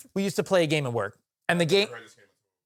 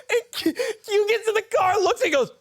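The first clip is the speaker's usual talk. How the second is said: laughing to tears. Real, not acted. Laughing hard, near tears.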